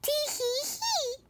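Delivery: high, dainty tee-hee giggle; tittering. Acted. Lady giggling at the dinner table, high titter. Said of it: "twittered and tittered".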